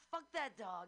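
mock angry yell. Cat fan yelling at dog pic.

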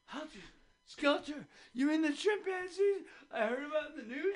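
high, excited jogger voice, laughing; mock passerby thrilled to spot them; a laughing, high-pitched stranger. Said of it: "they're like".

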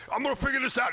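high, eager mock skydiver; hammed-up can-do voice; squeaky determined mock jumper; mock determination. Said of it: "he'd be like".

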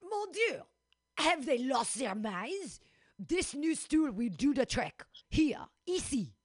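put-on lightly French-accented chef; a hint of a French cook. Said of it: "That's French for here".

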